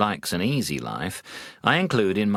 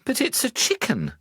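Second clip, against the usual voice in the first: almost in tears. Acted. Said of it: "The wife was almost in tears".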